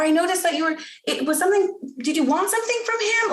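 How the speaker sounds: high, tentative parent voice; gentle curiosity. Acted. Parent guesses what the child wanted, gently curious. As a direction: float guesses in a lifted voice.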